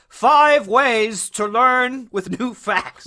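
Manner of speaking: loud, slow, high presenter pitch; drawn-out hammed-up how-to headline; video hook. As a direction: belt out a mock call to action. Improvises a video call to action.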